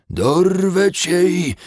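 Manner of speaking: high, loud, slow dwarf shout; shouted dwarf threat. Acted. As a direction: scream the threat from the mud. Dwarf repeats threat louder. (wrzeszczący krasnolud)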